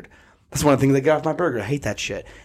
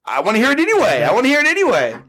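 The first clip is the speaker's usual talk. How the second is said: much higher, louder, in a put-on voice; high-pitched playful insist; 10 semitones higher, 4 dB louder, faster than usual. Real, not acted. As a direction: squeal it like a teasing demand.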